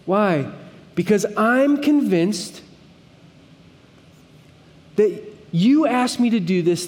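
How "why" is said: ordinary talk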